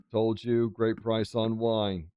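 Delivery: ordinary talk, nothing marked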